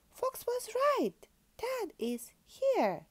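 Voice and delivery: excited cry. Acted. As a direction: squeal it with joy. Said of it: "Patty cried".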